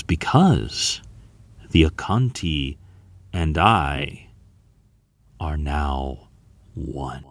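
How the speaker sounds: low, halting, with long pauses; grave pronouncement, dropping away on the last word; solemn revelation. A narrator's reading. Storm's solemn closing revelation.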